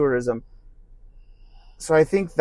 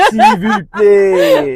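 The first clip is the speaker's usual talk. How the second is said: very high, slow mock French; exaggerated French accent; 9 dB louder, much slower than usual. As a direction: stretch it out, hammed-up French. Hamming up French phrases.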